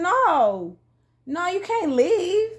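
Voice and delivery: high, drawn-out retold plea; pleading protest. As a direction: beg them to stay, stretching it out. Retold plea not to leave.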